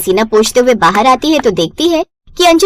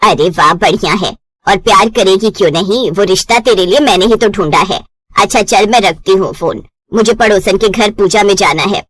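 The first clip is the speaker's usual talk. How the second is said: lower, knowing mother's warning; mother warns daughter she'll come running back, lower voice; 5 semitones lower than usual. Acted. Mother on phone.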